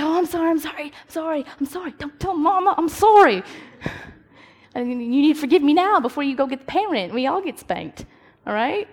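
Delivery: put-on a kid scrambling to get forgiven before Mama comes; pleading kid sister. Little sister begging not to be told on, high and frantic. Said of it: "be like".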